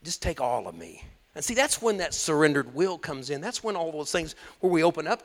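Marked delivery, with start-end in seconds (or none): 0.0-1.0 low, hushed prayer of surrender; much lower and softer, tenderly; loving devotion. Acted. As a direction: pray it softly from a devoted heart. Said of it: "heart of devotion, of love to him".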